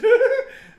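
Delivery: short high loud laugh. Real, not acted. Amused by a photo, high laughing squeal.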